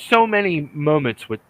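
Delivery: ordinary talk, nothing marked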